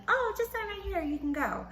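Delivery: high-pitched mimicry of a breezy nurse; mock her with a high, airy voice; mocking nurse imitation. Nurse's sudden reversal, mocked.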